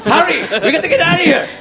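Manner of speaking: high, loud frantic yell; urgent shout. Acted. Jacques shouting to the party to flee, urgent yell. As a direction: yell it high, urging them on. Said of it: "He goes".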